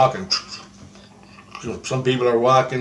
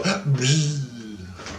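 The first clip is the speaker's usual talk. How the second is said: gasp, then a soft long buzz; imitated whizzing buzz; 5 dB quieter than usual. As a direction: mimic thumbnails whizzing past. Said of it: "and the thumbnails go".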